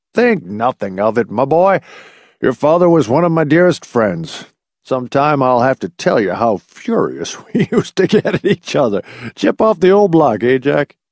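put-on chuckling elderly man brushing it off; hearty old family friend; old doctor, jovial. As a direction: reminisce fondly, with a little laugh.

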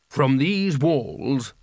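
slow, grand proclamation; declaiming wizard. A narrator's reading. Carding addresses the young sourcerer, slowly declaiming. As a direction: stretch it out ceremoniously.

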